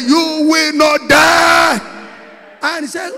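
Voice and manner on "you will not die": high, loud, long-stretched preacher's cry; climactic shouted declaration. Real, not acted. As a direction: bellow each word slowly as a decree. Final take of repeated declaration.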